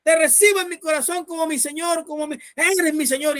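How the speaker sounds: high-pitched imitation of a frantic prayer; desperate crying out. Dying boy repeating the pastor's salvation prayer, desperate crying out. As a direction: cry it out high, as if pleading. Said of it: "y él gritaba".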